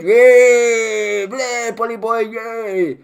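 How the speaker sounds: hammed-up jeering cheer from the benches; sarcastic mock cheer. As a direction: mimic MPs cheering the budget. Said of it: "they all cheered".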